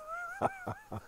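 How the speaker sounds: a sudden high laugh at the punchline; high burst of laughter. Real, not acted. Listener laughing at the sergeant's arrest punchline.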